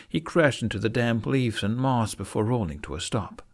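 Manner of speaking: ordinary delivery, nothing marked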